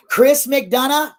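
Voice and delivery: taking a swipe at a rival channel; angry call-out. Real, not acted. Calling out a rival creator.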